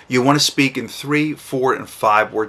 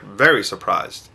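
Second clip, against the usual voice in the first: normal talking voice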